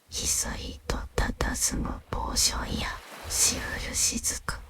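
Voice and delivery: ordinary delivery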